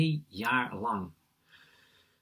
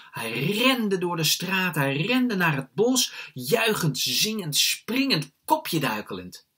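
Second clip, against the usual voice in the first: higher, louder, savoring each stretch; jubilant narration; 5 semitones higher, 5 dB louder, slower than usual. A narrator's reading. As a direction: build the joy, drawn out and loud. Narrator acts out jubilant escape.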